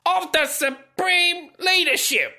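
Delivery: high, slow, hammed-up state propaganda voice; mock North Korean newspaper. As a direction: declaim it high-pitched and slow like a pompous decree. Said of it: "the North Korean newspaper went on to say".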